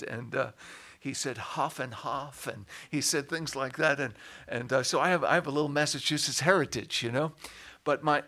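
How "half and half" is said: lightly imitated Boston accent; broad Boston "ah" in half. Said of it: "he had a Boston accent".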